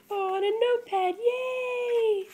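high, loud happy cheer; bright squeal of delight; delighted yay. Real, not acted. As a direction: cheer for the little notepad. Finds notepad, cheers.

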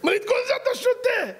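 high shrieking disbelief; shocked squeal. Retold or imitated. Speaker to salesman, shrieking in shocked disbelief. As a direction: squeal in shock at the filthy water.

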